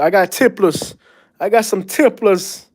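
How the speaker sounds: mock Puerto Rican New York accent; Puerto Rican Bronx accent. Imitating a pigeon friend from the Bronx, in a Puerto Rican New York accent. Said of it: "Puerto Rican Bronx, New York accent".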